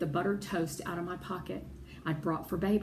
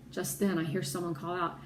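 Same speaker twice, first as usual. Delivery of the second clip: usual speaking voice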